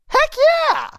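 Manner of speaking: excited cheer. Retold or imitated. Ark cheering yes to the goblin plan, high and loud. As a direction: whoop it with pure joy. Ark eagerly agrees, quoted.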